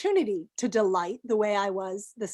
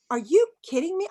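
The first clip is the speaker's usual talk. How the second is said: disbelieving, higher and louder; mock-stunned "are you kidding me"; incredulous; 6 semitones higher, 5 dB louder than usual. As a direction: retell your outrage with a raised voice. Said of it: "And I was like".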